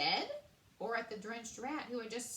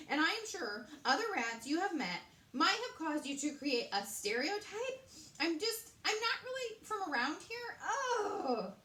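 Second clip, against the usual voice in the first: breathless rat; 7 semitones higher, 5 dB louder than usual. A narrator's reading. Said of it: "tried to explain breathlessly".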